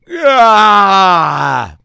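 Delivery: huge high yawn let all the way out; exaggerated roaring yawn; big loud yawn. Shown as a demonstration. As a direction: belt out a yawn with no holding back. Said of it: "Every time I yawn now".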